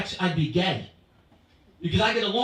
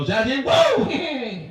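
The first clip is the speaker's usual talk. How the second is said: mock shocked laugh; 5 semitones higher, 6 dB louder, much slower than usual. Parent mocking own ugly kid.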